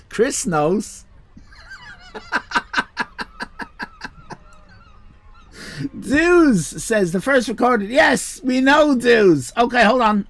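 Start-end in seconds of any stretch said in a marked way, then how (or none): 2.1-4.1 very high squealing giggle; squealing laugh. Real, not acted. High laugh after rant. 6.0-10.3 excited. Real, not acted. Reading chat excitedly.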